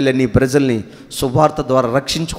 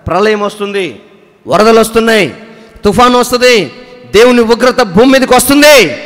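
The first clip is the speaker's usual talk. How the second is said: rising preacher's cry of doom, louder each line; building sermon warning; 5 semitones higher, 7 dB louder than usual. Acted. Preacher retelling Noah's warning to his people, building to a loud cry.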